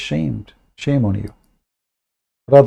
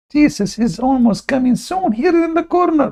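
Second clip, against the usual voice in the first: much higher, mocking falsetto; mocking squeal quote; 12 semitones higher than usual. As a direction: squeak the prophecy to ridicule it. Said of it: "he says".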